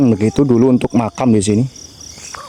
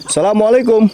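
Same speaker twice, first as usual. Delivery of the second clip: reverent greeting; 9 semitones higher than usual. Acted. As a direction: greet the graves with respect. Memberi salam di makam. (salam tinggi dan khidmat kepada penghuni makam)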